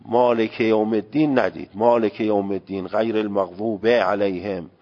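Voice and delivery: مالک with an open "e", maleke yawm; Persian pronunciation. Retold or imitated.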